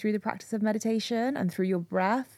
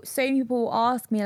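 ordinary delivery

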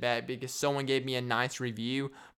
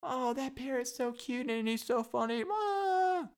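high cooing voice ending in a squeal; gushing audience member; 10 semitones higher, slower than usual. Pretend. Audience member gushes over the parrot, high cooing squeal. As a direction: coo over the cute bird. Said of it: "then you're like".

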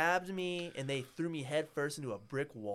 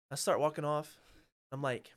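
usual speaking voice

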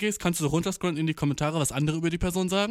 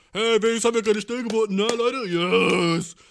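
hammed-up mock self; 4 semitones higher, 6 dB louder than usual. Mocking imitation of his own line.

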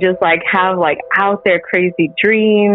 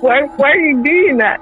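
higher, retold exasperation; mildly annoyed; indignant questioning; 6 semitones higher than usual. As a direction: act out pressing a sister for an answer. Acted morning quarrel with sister.

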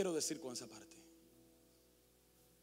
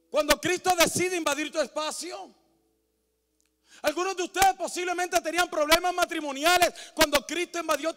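higher, loud, rising fervent sermon; preaching at full force; 12 semitones higher, 15 dB louder than usual. Acted. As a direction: drive each line harder toward the climax.

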